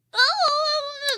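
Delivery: very high hammed-up wail; mock crying spell. Chessie learns it is Annie, mock crying spell. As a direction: squeal out a pretend meltdown. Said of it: "like, panic attack s-, slash crying spell".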